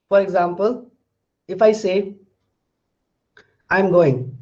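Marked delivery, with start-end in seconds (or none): 3.7-4.4 I'm going said as a plain statement, voice falling on going; just inform them: I'm going, falling at the end. Shown as a demonstration. Teacher showing students a declarative, falling tune. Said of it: "it's a declarative sentence".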